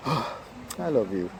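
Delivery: long sigh, then a slow, soft, half-hearted line; sighing, no meaning. Shown as a demonstration. Showing how not to tell a partner, sighing and meaningless. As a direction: say it softly and slowly, without meaning it. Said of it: "it don't show any meaning".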